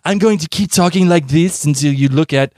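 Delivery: imitating a woman mocking a French accent; teasing fake French accent. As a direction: poke fun with a put-on French accent. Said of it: "making fun of his accent".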